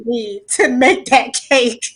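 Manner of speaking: a real catch of tears through a small laugh; tearing up. Real, not acted. Remembering her late aunt's pound cake: real tearful laugh. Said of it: "I'm kinda tearing up about it".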